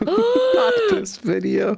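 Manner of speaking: squealing through a laugh, slow; laughing high squeal. Real, not acted. Laughing high at the friend who wants to play the video.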